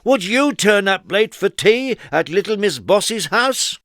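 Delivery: high, loud rhetorical question; crowd challenge. A narrator's reading. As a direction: ask it knowingly. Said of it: "crowd asking Trouble".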